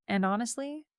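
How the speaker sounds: usual speaking voice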